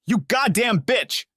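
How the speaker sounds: slow furious yell, pitch flying high. A narrator's reading. Tate finding his wife with his sister, slow furious yell. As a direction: spit it out slowly in a rage. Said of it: "he yelled".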